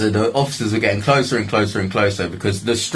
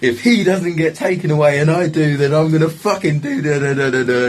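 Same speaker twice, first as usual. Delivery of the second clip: so angry; 10 semitones higher, 3 dB louder than usual. Retold or imitated. Man telling officers to remove attacker, angry rant. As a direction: demand it in a high, straining voice. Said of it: "'Cause I was so angry".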